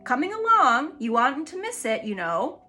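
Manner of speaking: high, loud invitation; eager lion calls them along. A narrator's reading.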